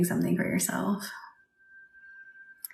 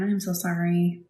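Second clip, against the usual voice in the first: ordinary talk, nothing marked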